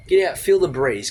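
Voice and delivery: ordinary talk, nothing marked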